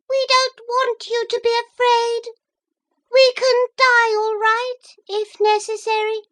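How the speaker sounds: very high thin voices, bravely resigned; brave tiny mussel voices. A narrator's reading. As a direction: noble and resigned shellfish. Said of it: "thin, sharp voices".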